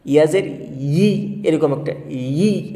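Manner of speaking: ইয়াজের ই with ই stretched slowly and long; drawn-out ee. Shown as a demonstration. Teacher showing students the long ই sound, slowly drawn out. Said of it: "এরকম একটা উচ্চারণ হবে".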